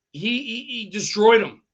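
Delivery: ordinary delivery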